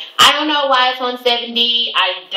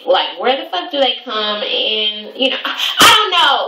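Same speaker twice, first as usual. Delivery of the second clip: exasperated; 4 semitones higher than usual. Real, not acted. Exasperated outburst about weird client.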